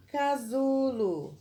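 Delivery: casulo slowly, zu clearly voiced; slow dictation. Shown as a demonstration. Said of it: "apesar de ter o som de Z".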